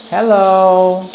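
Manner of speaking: high, drawn-out singsong greeting; singsong call. Real, not acted. Trainer calling out to the dogs, high singsong call. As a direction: call out high, stretching the last vowel.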